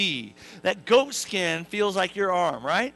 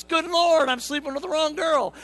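shocked; 9 semitones higher, 5 dB louder than usual. Acted. Jacob waking beside wrong wife.

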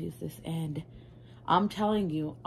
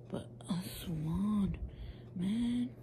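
whisper; 7 dB quieter, slower than usual. Real, not acted. Admiring a swatch.

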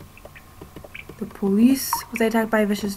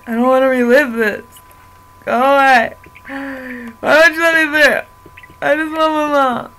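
loud, raised-voice protest; distressed outburst; 3 semitones higher, 7 dB louder than usual. Acted. Child forced to relive mother's death, crying out in protest. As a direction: cry out like an upset child.

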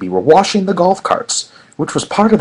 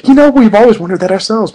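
Madeline's voice; 8 semitones higher, 8 dB louder than usual. A narrator's reading.

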